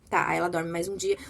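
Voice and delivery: normal talking voice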